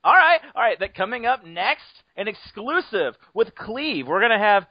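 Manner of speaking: mock segment announcer. Host introducing an exclusive segment, mock announcer hype. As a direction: tease the next segment with stretched-out fanfare.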